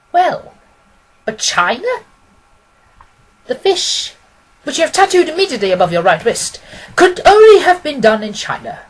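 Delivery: but China, how?; puzzled question. Acted. Client challenges Holmes about China, lightly surprised. Wilson then Holmes answering.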